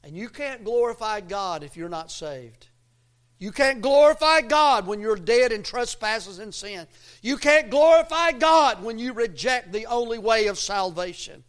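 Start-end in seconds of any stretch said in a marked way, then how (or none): 3.4-11.4 loud, insistent pulpit delivery; forceful preaching. Real, not acted. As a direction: drive each warning home to the congregation.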